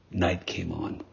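hushed whisper. A narrator's reading. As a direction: breathe it softly into the dark. Narrator hushes for nightfall.

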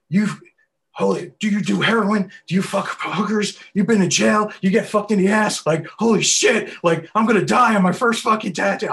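loud, high-pitched frantic string of questions; retold freak-out at the top of the voice; panicked rambling. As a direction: ramble hysterically, voice way up. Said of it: "I start rambling off like an idiot".

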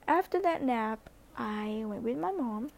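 normal talking voice

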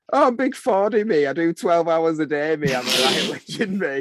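high, loud mocking boast of big Fordy; boss's mocking impression. Boss mimicking him to his mate, high and loud in mockery. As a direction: ham up a cocky boast in a high silly voice. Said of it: "you do quite a good impression of Danny".

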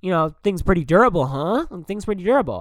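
a hint of a clueless dude pondering; mocking Jerry. Retelling Jerry's dumb question in a mild mock voice. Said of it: "And he goes".

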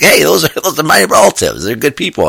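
high, loud, laughing objection; mock protest. Law student defending his hillbilly relatives to a friend, mock protest. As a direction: pipe up in amused defence of your family.

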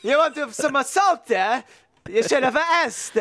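hammed-up Italian accent, pitched high; bad sing-song Italian accent, played for laughs; botched Italian accent. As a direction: do a cartoonish, unconvincing Italian accent. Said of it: "that's not an Italian accent".

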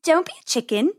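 a hint of a taunting bunny sister; Penny. A narrator's reading. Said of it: "said Penny".

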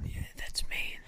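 breathy conspiratorial whisper. Real, not acted. Guest whispering to listeners that he is the one. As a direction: whisper it like a shared secret. Guest confirming in hushed aside.

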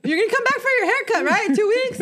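high, fast, laughing "you're gonna come back"; laughing mock plea. Carla to crying kids, fast high laughing plea. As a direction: rush the question through laughter. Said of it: "Carla's like".

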